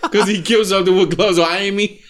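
laughing through the words; cracking up mid-sentence. Real, not acted. Cracking up at O J joke.

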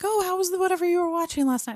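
high, quick mom voice; lightly acted, high-pitched small talk; casual mom. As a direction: imitate mom chirping a breezy morning question. Voices mom's casual morning question.

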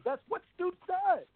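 high-pitched, exasperated insistence; fired-up rant. Real, not acted. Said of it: "the end of [name]'s rant".

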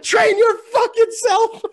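high, loud, slow screaming; furious shout. Retold or imitated. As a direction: hurl the words in rage. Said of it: "I was like".

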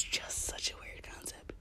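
whispered aside, barely voiced. Real, not acted. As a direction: whisper it to yourself. Whispered aside then repeated plainly.

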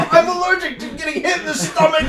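high, fast laughing yell; laughing shout. Acted. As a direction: shout it while cracking up. Hit in stomach, laughing.

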